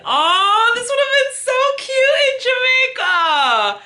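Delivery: high excited squeal; thrilled gush. Real, not acted. Admiring the necklace.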